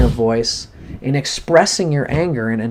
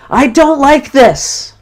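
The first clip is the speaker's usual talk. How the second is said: much higher, louder and slow, don't hammered; angry yell; 13 semitones higher, 7 dB louder, slower than usual. Shown as a demonstration. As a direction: shout it out as you hit the pillow. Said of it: "And you punch the pillow".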